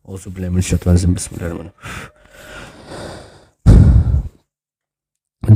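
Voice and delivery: soft, quick then slow murmured prayer; hushed Arabic invocation; whispered prayer. Real, not acted. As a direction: whisper a protective prayer to yourself. Protective prayer after feeling fear.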